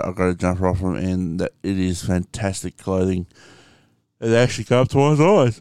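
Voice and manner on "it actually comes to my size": words stretched by a yawn. Real, not acted. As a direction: talk through a yawn. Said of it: "I'm yawning".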